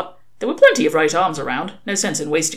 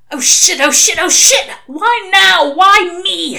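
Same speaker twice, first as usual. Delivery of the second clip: much higher, louder panicked cursing; panicked outburst; 14 semitones higher, 8 dB louder, slower than usual. A narrator's reading. Crowley alone in his car, panicking as Queen plays, louder and higher. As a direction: blurt it out in rising panic. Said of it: "he muttered".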